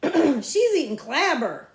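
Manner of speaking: put-on high, loud, slow sneering girl; mock her lunch with disgust; snotty girl.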